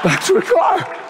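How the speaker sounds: high, squeaky comic retreat; mock spooked. Mother-in-law creeping away from the screaming, mock spooked.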